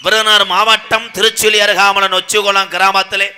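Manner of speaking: loud, declaimed stage announcement; stage self-introduction. Acted. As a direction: announce yourself proudly to the whole crowd. Introduces his mother's village.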